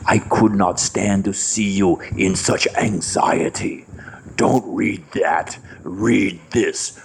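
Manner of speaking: low, slow, unbothered Manihar; calm devotee. Retold or imitated. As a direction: soothe him while the paper burns. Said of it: "He would say".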